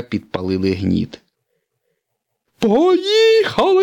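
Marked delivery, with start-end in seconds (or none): 2.6-3.8 high, loud, slow yell; launch shout. Acted. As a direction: bellow it as the rockets fire. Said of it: "закричав сирник".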